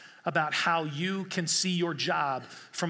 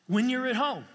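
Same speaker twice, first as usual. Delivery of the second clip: higher, louder rallying preacher voice; rousing preaching; 6 semitones higher, 3 dB louder than usual. Acted. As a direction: lift them with each wherever. Preaching at full force.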